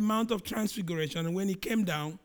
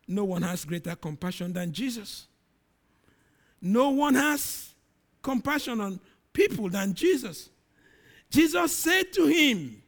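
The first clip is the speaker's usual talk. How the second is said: ordinary delivery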